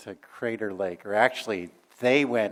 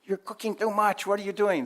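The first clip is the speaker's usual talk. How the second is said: scolding dad; 10 semitones higher than usual. Retold or imitated. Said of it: "It's like".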